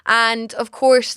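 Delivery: usual speaking voice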